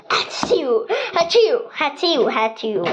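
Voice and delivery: string of playful fake sneezes; mock sneezes. Toy animal sneezing in the house, mock sneezes. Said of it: "Täällä ei saa aivastella".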